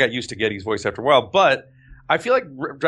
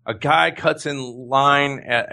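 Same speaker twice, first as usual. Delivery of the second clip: ordinary talk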